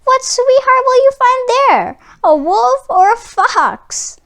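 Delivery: high, loud taunting; mocking taunt. A narrator's reading. Brother taunting Miko about the forest, high jeering. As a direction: tease him about wolves and foxes. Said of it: "his brother mocked".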